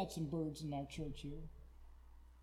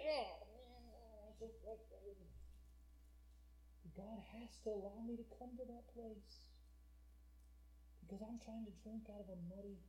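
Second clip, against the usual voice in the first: hushed preaching; 3 semitones higher, 15 dB quieter than usual. Acted. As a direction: drop to a very soft, confiding sermon voice. Preaches in a sudden hush.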